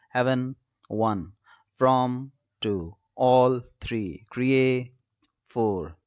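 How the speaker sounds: slow, separated syllables, each followed by its number; syllable-by-syllable count. Shown as a demonstration. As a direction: count out the line piece by piece, pausing between. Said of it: "dissected these lines into ten syllables".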